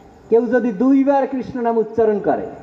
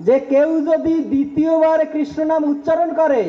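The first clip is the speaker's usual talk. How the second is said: raised, ringing proclamation; fervent preaching; 5 semitones higher than usual. Acted. Preacher proclaiming to the devotees, raised and fervent. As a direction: announce the heavenly reward.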